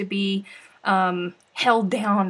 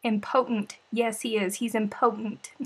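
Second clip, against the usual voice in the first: normal talking voice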